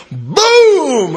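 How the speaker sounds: loud, drawn-out, high boom; explosive boom. Retold or imitated. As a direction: let it go off like a bomb. Imitating a bomb dropped in talk.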